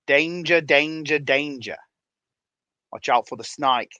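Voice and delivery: danger and snake with Australian I vowels; joking Australian accent demo; showing viewers how to sound Australian; mock Australian. Shown as a demonstration. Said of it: "That's how you sound Australian".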